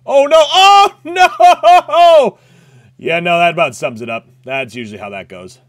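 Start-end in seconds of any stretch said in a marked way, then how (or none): 0.0-2.4 loud high panicked scream; shrieking oh no. Real, not acted. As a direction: yell in panic as you die. Dying in game, screaming.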